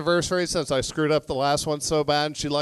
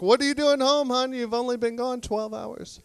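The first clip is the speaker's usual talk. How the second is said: high, sing-song innocent voice; fake-innocent greeting to an angry wife; mock innocent husband; 7 semitones higher than usual. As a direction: play dumb sweetly, pitched up.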